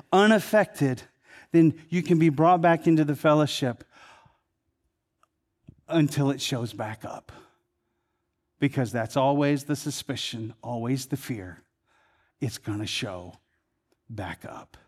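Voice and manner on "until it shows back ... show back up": dropping to a near whisper; fading hush. Acted. Preacher voices the lepers' dread, sinking to a hush. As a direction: let the fear sink the voice low and soft. Sermon on fear of relapse.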